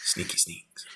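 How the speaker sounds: sneaky hushed whisper. Real, not acted. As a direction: breathe it like a secret. Player keeps whispering playfully.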